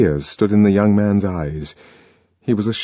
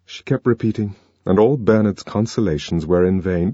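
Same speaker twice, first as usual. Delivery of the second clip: ordinary talk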